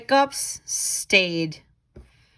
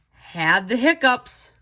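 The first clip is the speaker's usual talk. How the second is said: ordinary delivery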